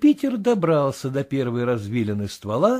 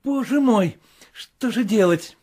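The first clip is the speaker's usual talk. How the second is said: higher, desperate exclaim; in despair; 6 semitones higher, 3 dB louder than usual. A narrator's reading. Master cries out in despair. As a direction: cry out in despair. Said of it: "воскликнул Легран, как видно, в отчаянии".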